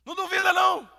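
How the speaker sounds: fiery shout; shouted preaching. Shown as a demonstration. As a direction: cry out sem dúvida. Shouted take.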